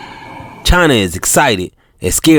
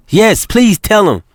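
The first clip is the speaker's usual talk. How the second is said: eager agreement; 10 semitones higher than usual. Acted. China agreeing with Uncle Johnny.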